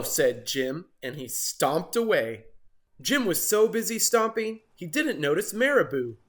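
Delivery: ordinary delivery, nothing marked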